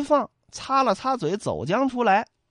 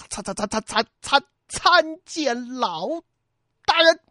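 terrified stammer; 6 semitones higher than usual. Acted. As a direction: trip over every syllable before bursting out the last word. Terrified man greets the prefect.

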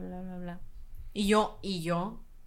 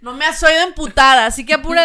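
mock furious shout; 4 semitones higher, 11 dB louder than usual. Joking angry customer.

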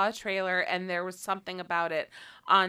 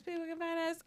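much higher playful sign-off; high-pitched sign-off; 12 semitones higher, 10 dB quieter, faster than usual. Pretend. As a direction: sing it out up high.

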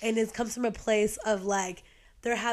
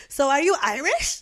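higher, louder mock barroom inquiry; mock nosy question; 5 semitones higher, 6 dB louder than usual. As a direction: ask it loudly with put-on curiosity.

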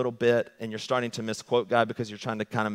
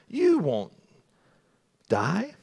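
lightly sly serpent; 4 semitones higher, much slower than usual. Retold or imitated. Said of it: "But he says".

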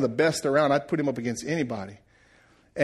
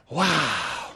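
awestruck wow. Retold or imitated. Man retelling watching the carpenter work, awestruck wow. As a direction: stretch it out in wonder. Retells his awe, repeated.

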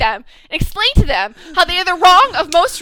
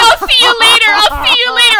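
a hint of a tearful farewell, raised; lightly voiced goodbye, pushed higher and louder; imitating the daughter; 6 semitones higher, 6 dB louder than usual. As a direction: play the girl saying goodbye, half in earnest. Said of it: "And then she's like".

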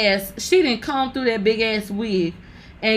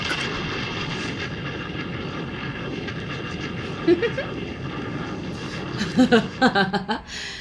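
long, silent wheezing laugh; breathless laugh; 9 dB quieter than usual. Real, not acted. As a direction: collapse into soundless laughter. Cracking up at her own joke.

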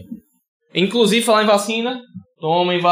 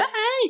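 whiny mock cry; 8 dB quieter than usual. Mocks fans' whiny cry.

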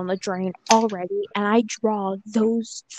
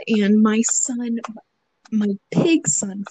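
normal talking voice

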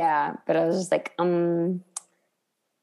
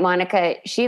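usual speaking voice

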